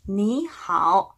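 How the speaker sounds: slow model "니 하오", rising 니, then dipping-rising 하오; tone-change rule applied. Shown as a demonstration. Teacher models for repetition, slow second-then-third tone. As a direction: glide up on 니, dip and lift 하오, unhurried. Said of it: "다시 한번 읽어볼게요".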